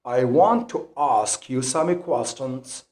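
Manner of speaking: British "ask" with the A of father; British accent. Shown as a demonstration. Teacher giving learners an example sentence, British accent. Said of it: "the British accent when you pronounce these two words".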